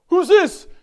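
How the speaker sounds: high, very loud mock demand; hammed-up indignant shout; imitated brother-in-law. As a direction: bellow it like a worked-up relative on the phone. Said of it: "I can imitate his voice".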